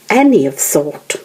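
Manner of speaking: ordinary delivery, nothing marked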